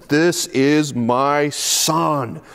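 very slow, drawn-out divine announcement; a lightly voiced, slow solemn God. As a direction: stretch each word like a voice from heaven. God's voice comes in the cloud.

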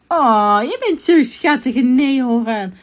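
loud, drawn-out cooing; gushing. Acted. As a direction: princess fawning over a cute animal. Princess gushes over the neehoorn.

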